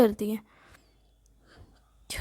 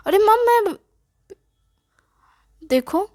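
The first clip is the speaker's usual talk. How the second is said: put-on much higher, louder childish whine; whiny whimper; 8 semitones higher, 4 dB louder than usual. Son whining to his mother about bathing, childish whine. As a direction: whine like a spoiled kid.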